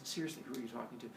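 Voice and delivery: low, soft, rapid remark; exasperated aside. Acted. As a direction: toss it off fast under your breath. Father Sky mutters aside, exasperated.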